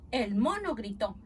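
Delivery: ordinary delivery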